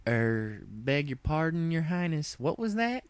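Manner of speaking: as if unsure she heard right; baffled country pony. Acted. Applejack baffled by what the princess just mumbled, rising high voice. Applejack confused by Luna's remark.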